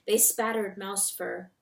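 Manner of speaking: ordinary delivery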